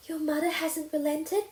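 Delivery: Anne's gasped question, voiced high; a breathless, alarmed little question; gasping. A narrator's reading. Anne gasping at Diana's bad news, alarmed. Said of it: "she gasped".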